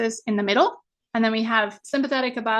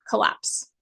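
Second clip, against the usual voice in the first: breathy collapse. Shown as a demonstration. Coach describing the freeze response, voiceless breathy word. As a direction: let the word collapse sink out unvoiced. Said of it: "we start to".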